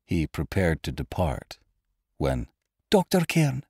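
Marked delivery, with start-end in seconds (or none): 2.9-3.6 high, fearful woman's voice; tremulous plea. A narrator's reading. Frightened patient stopping the departing doctor, high and tremulous. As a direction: call to him high and trembling. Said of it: "whispered Lady Lashmore tremulously".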